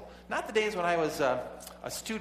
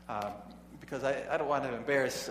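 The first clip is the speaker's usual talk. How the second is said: ordinary talk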